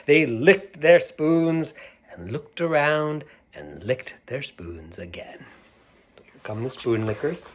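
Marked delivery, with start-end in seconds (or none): none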